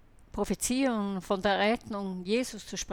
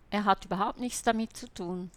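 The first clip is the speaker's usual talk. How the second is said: ordinary delivery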